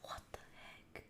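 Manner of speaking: faint, voiceless whisper; shocked whisper. Real, not acted. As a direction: whisper it under the breath in disbelief. Stunned by repeated gifts.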